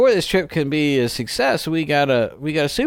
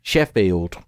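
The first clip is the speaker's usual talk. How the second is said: the word Sheffield said low in a Sheffield accent; 9 semitones lower than usual. Shown as a demonstration. Teaching the UK listeners a Sheffield accent on Sheffield. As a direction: say Sheffield like a local from Sheffield, lower. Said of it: "I'm going to say the word Sheffield in a Sheffield accent".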